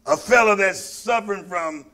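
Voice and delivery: ordinary talk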